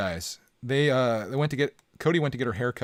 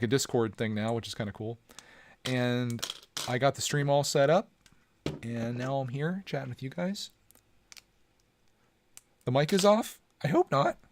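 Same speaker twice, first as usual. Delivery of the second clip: usual speaking voice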